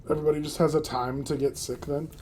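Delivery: ordinary talk, nothing marked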